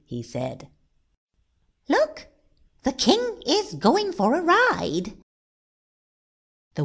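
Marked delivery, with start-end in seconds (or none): 1.9-5.2 high-pitched, excited pointing-out; excited onlooker. A narrator's reading. Onlookers spot the king going for a ride, excited. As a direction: call attention in a much higher voice.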